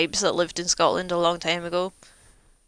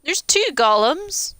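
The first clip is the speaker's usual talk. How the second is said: surprised; 10 semitones higher, 8 dB louder, slower than usual. Real, not acted. Spots a second golem in game.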